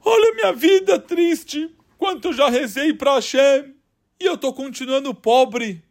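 a retold lament, halfway to tears; tearful lament. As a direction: mourn his poverty in a pleading voice. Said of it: "comecei a chorar".

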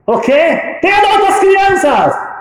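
high, loud, slow cry of disbelief; shocked protest. Retold or imitated. Husband protests to his wife, high and shocked.

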